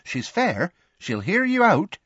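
high, emphatic reassurance; earnest character voice, high and keen. A narrator's reading. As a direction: insist on it sincerely, pitched well up. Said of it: "said Tonks earnestly".

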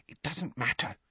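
soft hushed reassurance; whispered. A narrator's reading. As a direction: say it under your breath. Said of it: "Hermione whispered".

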